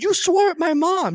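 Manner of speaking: high, loud, slow accusation; indignant. Retold or imitated. Son scolding his companion in the car, high and indignant. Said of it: "It's like".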